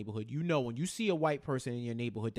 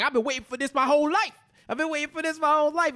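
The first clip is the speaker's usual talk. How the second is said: much higher, louder and fast; hyped movie quote; 10 semitones higher, 6 dB louder, much faster than usual. Retold or imitated. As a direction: burst out like it's your moment.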